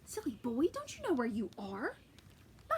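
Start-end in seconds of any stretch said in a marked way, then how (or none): 0.0-0.7 hushed, breathless warning; whisper. Acted. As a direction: whisper so no one hears. Giantess scolds Jack.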